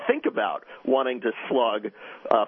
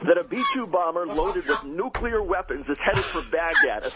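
higher, urgent mock live broadcast; reenacted on-air report, pitched up and dramatic; belted-out fake news report; 5 semitones higher than usual. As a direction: belt out an alarming breaking-news bulletin for a prank. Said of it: "And then I belt out".

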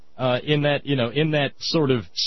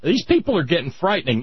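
ordinary talk

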